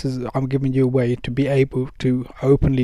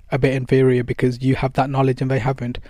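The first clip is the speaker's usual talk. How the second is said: usual speaking voice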